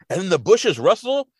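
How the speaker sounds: ordinary talk, nothing marked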